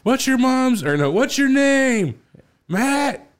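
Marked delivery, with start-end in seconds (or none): 0.0-0.8 high-pitched imitation of a yelling man; mock yelling. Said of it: "those two guys yelling back and forth". 1.1-3.3 very high, drawn-out imitated yelling; mock yelling. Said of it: "those two guys yelling back and forth".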